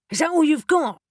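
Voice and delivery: testy demand at the spirit; impatient. A narrator's reading. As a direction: push him, losing patience. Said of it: "growing impatient".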